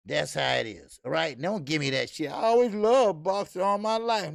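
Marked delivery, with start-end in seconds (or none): 2.3-4.3 mock Jake Paul. Mocking Jake Paul's claim.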